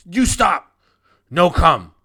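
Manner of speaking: high, loud warning shouts from little guards; gully dwarf guards shouting. Acted. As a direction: yell them back out the door, high and loud. Guards block party with spears.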